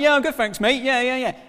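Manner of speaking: high, loud, gushing fan; gushing fan at the hotel door, high and eager; starstruck reply. Retold or imitated. Starstruck concierge greeting guest, high and gushing. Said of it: "I was like".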